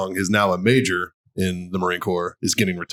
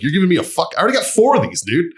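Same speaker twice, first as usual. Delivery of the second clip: indignant; 8 semitones higher, faster than usual. Retold or imitated. Retold retort to commander.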